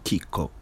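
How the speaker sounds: KIKO with the accent on KI, falling away on KO; stress on first syllable. Shown as a demonstration. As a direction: say KIKO as KEE-ko, softly and low. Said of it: "Accent in the first syllable".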